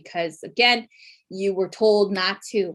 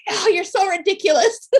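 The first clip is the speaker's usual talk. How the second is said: high, louder exasperated voice; exasperated mom; 12 semitones higher, 6 dB louder than usual. Retold or imitated. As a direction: roll your eyes at her nonsense. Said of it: "she's like".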